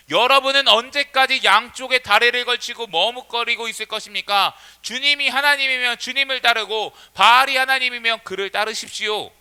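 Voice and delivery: high, loud prophetic challenge; impassioned challenge. Acted. Prophet confronting the people over divided loyalty, impassioned challenge. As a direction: press the crowd hard to choose.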